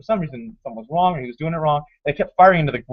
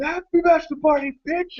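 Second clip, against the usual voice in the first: high, jubilant congratulation, held back in volume; retold celebratory cry. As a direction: act out cheering the groom.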